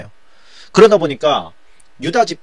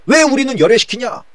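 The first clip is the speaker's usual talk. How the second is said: high-pitched, aggrieved acted complaint; quarrelsome protest; 9 semitones higher than usual. Northern tribes complaining they are left out, quarrelsome high protest. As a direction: protest hotly, voice pitched right up. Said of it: "막 그러면서 거기서 논쟁이 붙은 거예요".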